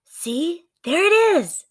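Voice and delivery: put-on high, slow, bright-eyed girl's voice; little girl Annie. Narrator voicing Annie spotting the treehouse, high and eager.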